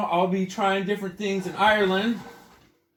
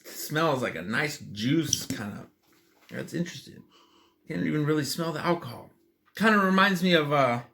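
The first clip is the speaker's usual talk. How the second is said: ordinary delivery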